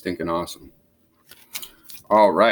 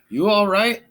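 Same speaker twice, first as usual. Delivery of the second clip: anxious; 13 semitones higher, 3 dB louder than usual. Acted. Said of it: "asked Hippo anxiously".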